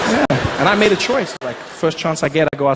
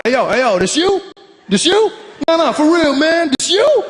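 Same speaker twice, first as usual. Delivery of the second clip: much higher, louder friendly hype; loud, high imitated street greeting; 10 semitones higher, 3 dB louder than usual. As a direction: hail him like an old friend. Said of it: "They just walk up to me like".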